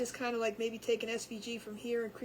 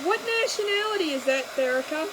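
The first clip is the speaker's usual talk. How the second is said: louder, higher, lively exclaiming; animated; 6 semitones higher, 6 dB louder than usual. Real, not acted. To the chat about the hard name, animated. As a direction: marvel at the difficult name.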